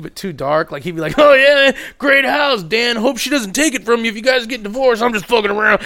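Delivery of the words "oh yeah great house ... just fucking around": put-on jokey drunk friend. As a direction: ham up the tipsy guy's dark joke. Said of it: "jokey shit where, where it was jokey".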